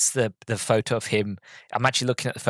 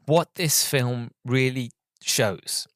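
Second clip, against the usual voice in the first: ordinary talk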